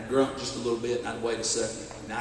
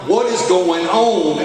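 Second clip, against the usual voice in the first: loud, slow, high frustrated outburst; furious at the broken gun; 5 semitones higher, 9 dB louder, slower than usual. Retold or imitated. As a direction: bark out the question in disbelief. Gun clicks again.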